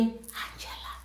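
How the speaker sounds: hushed, voiceless name-call, like a phantom whisper; quiet whisper. Acted. Unseen caller at a listener's ear, quiet whisper. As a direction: whisper it right inside the ear. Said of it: "quiet whisper inside your ear".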